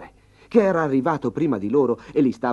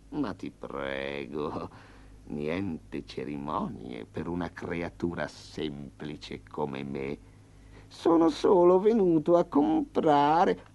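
slow, smooth tiger bargaining with menace; a polite predator in no hurry; lightly voiced tiger; 6 semitones lower, much slower than usual. Tiger demanding to buy intelligence from the farmer, smooth and slow.

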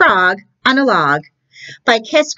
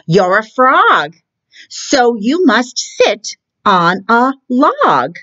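slow, higher, spelling out the rule; slow insistent cat; 6 semitones higher, slower than usual. Acted. Cat lectures frog on where frogs sit, slow and insistent. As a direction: drag it out like an obvious lesson.

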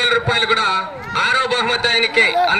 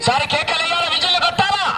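higher, carrying announcer's call; crowd-rallying announcer; 9 semitones higher than usual. Real, not acted. Announcer asks the crowd for cheers and whistles, rousing. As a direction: work the crowd up for applause.

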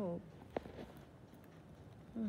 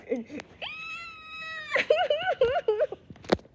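very high, loud squealing laugh; shrieking laugh; 3 dB louder than usual. Real, not acted. Shrieking laugh after dying in game.

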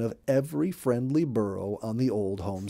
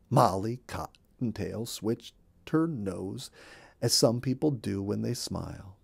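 usual speaking voice

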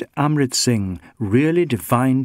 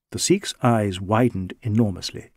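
usual speaking voice

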